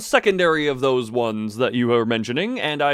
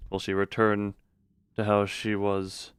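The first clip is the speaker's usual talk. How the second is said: story narration; 4 semitones lower, 5 dB quieter than usual. A narrator's reading. Reads game narration.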